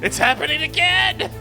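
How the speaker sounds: shrill mock panic, high and loud; panicked yell. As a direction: yell it like a flashback hits. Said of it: "I'm like".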